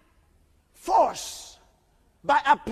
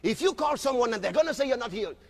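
ordinary talk, nothing marked

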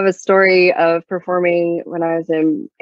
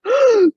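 imitated gasp. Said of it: "like, a gasp".

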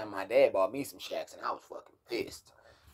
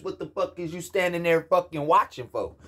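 angry yelling; 5 semitones higher than usual. Retold or imitated. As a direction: yell at him to get out of the way. Brother-in-law yelling about couch.

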